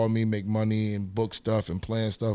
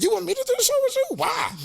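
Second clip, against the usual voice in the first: much higher, fast disbelieving squeak; incredulous question; faster than usual. Retold or imitated. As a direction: act stunned that he's asking you. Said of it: "That's how I said, just like that".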